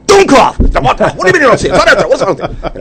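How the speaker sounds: screaming fast and high at a student; a German professor shrieking at a pupil; furious shout. Retold or imitated. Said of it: "the professor's like".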